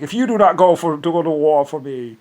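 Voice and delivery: a lightly voiced tyrant ordering; mock tyrant threat. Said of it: "because it's like".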